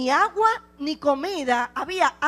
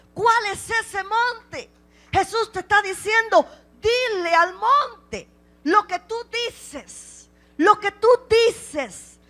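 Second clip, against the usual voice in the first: high, slow, insistent pulpit delivery; emphatic preaching; 4 semitones higher, slower than usual. Acted. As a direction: press each word on the listeners. (predicación lenta e insistente)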